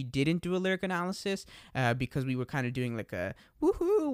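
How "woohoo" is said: mock woohoo. Mock cheer inside sentence.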